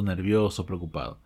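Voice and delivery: normal talking voice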